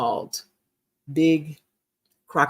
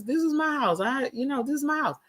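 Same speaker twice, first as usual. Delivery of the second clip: crying retold; 8 semitones higher, 5 dB quieter than usual. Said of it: "I was crying".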